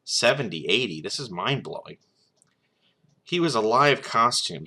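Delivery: plain reading voice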